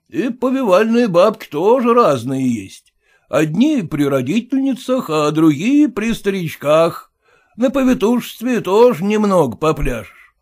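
blunt merchant scoffing at formality; gruff merchant. Acted. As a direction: push in like the owner. Merchant insinuates.

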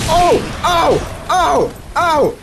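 high, quick "oh" cries; string of high-pitched ohs; alarmed yelps. Real, not acted. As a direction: yelp high with each hit. Getting hit in the game.

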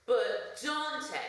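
high, drawn-out mock plea; imagined viewer's cry. An imagined viewer interrupts Dante, high mock cry. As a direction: cry out the name in a high put-on voice. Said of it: "I hear you cry".

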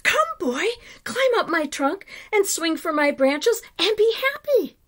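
high, loud, joyful call; overjoyed. A narrator's reading. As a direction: call out to the boy, bursting with joy. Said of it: "the tree shook with joy".

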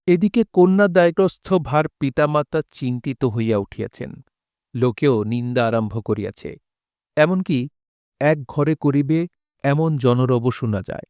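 ordinary talk

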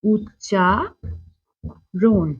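each word stretched very slowly; drawn-out title. Shown as a demonstration. Teacher announces today's pronunciation lesson, drawn-out and slow.

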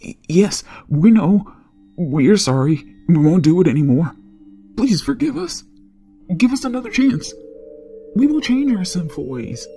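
high, stammering, frightened pleading; begging for mercy. Acted. Robbers begging forgiveness at his feet, high and frightened. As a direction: grovel and plead, pitch climbing. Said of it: "They begged me for forgiveness and mercy".

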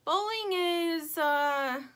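high, drawn-out hesitation; stalling for an answer. A narrator's reading. Stingray wants to give Lumpy an answer, stalling. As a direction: stretch it out slowly, searching. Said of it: "Stingray pauses for a moment".

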